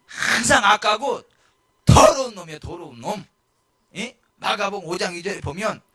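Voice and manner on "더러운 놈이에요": ordinary talk, nothing marked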